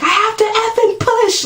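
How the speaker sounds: very high mock scream; imitating a labor scream to the nurses; retold scream. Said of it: "I was screaming that".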